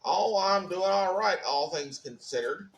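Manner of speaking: usual speaking voice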